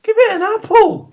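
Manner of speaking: high squeaky cartoon voice. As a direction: pipe it up very high. Player chimes in with advice.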